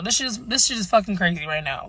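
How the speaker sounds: usual speaking voice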